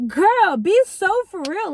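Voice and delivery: high, emphatic mock scolding; playful reality check. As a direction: pitch it up and call out a friend's nonsense. Mock scolding her own doubts.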